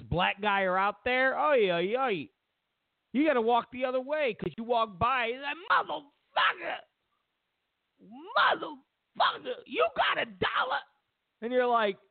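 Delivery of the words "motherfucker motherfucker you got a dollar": much higher shrieking yell rising to a begging squeal; bum screaming. Retold or imitated. Comedian imitates street bum screaming for a dollar, shrieking. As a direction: shriek the curses then beg. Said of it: "screaming at me".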